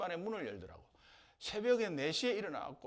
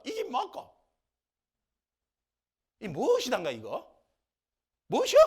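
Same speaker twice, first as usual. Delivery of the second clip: high, loud contemptuous questions; sneering complaint; 6 semitones higher, 11 dB louder than usual. Retold or imitated. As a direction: sneer at the manna. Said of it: "이렇게 하는 거예요".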